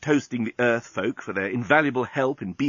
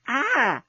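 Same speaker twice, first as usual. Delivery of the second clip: high pleasured ah; a sighing ah of pleasure; breathy high ah; 13 semitones higher than usual. A narrator's reading. Lucy to journalist in passion, high ah. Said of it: "said Lucy".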